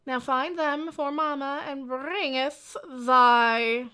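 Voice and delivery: high, slow, archaic command; archaic mother figure. Acted. Mama demanding they find her circuit completer, high and slow. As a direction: intone it like a mock oracle.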